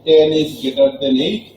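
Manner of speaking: slow teacher model; slow demo reading. Shown as a demonstration. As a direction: spell it out for students. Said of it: "আমরা দুইভাবেই উচ্চারণ করি".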